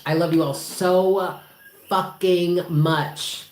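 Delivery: so fucking much stretched very slow; drawn-out emphasis. Real, not acted. Telling viewers she loves them, drawn out slowly.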